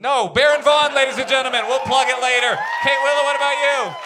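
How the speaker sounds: rally the crowd for a round of applause; announcer sign-off. Acted. Host thanking the guests on stage, announcer voice.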